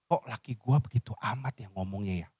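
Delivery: low, soft grumble to oneself; acted brooding wife. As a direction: imitate a wife's private brooding. Voices a wife brooding in bed. (gerutu pelan istri, dibuat-buat)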